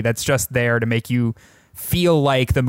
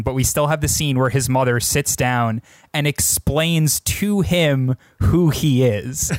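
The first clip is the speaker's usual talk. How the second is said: ordinary talk, nothing marked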